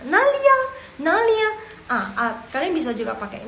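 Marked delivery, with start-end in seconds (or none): 0.1-1.5 high, loud "where?" call; demonstrating someone asking aloud; acted question. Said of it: "Tadi dia nanya".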